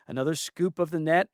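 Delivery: ordinary delivery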